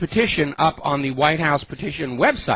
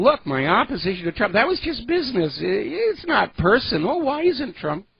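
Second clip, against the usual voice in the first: mock the snubbed pundit begging for a call; mocking whiny pundit; 7 semitones higher than usual. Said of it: "they're out there saying".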